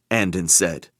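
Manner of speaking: ordinary delivery, nothing marked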